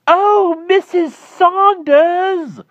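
very high, very loud, drawn-out call. Acted. Klausner hailing Mrs Saunders across the garden, shrill holler. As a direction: stretch her name out in a shrill holler. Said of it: "calling to her in a voice so high and loud".

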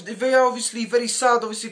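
ordinary talk

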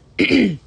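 hard clearing of the throat; real throat clear. Real, not acted. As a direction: clear the cereal from your throat. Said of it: "Honey Smacks is stuck in my throat".

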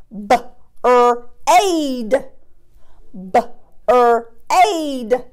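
B-er-ad stretched into separate sounds, slowly. Shown as a demonstration. Teacher sounding out a word for young pupils, stretched. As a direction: sound out B-er-ad segment by segment. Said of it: "what about this word".

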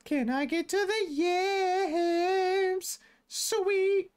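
very high, slow cartoon creature voice; high squeaky pretend Pokemon voice. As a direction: squeak it out like a tiny hungry critter. Voicing the creature's thoughts.

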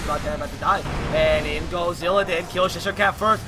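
loud, building esports shout-cast; excited play-by-play. Acted. Live play-by-play of a teamfight.

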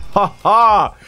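a gleeful ha-ha, high and loud; triumphant laugh. Real, not acted. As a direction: gloat with a laugh. Triumphant laugh after kill.

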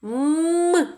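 teacher isolating М; isolated sound М. Shown as a demonstration. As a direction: hum the lone М for the children. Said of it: "Який перший звук ми чуємо?".

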